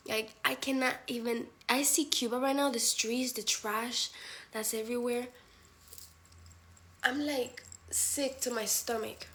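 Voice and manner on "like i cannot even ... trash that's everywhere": ordinary talk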